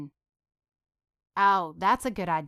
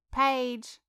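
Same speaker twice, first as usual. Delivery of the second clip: louder, more insistent call; 5 semitones higher, 4 dB louder than usual. A narrator's reading. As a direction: call again, more urgently. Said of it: "tried to get her friend's attention".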